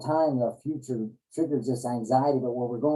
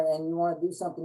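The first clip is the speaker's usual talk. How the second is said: normal talking voice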